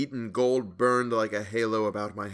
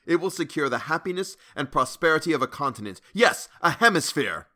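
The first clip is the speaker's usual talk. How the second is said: grand, louder proclamation; rising fervour; 5 semitones higher, 5 dB louder than usual. A narrator's reading. Narrator boasts to Hawberk, growing fervent. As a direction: swell into a loud boast.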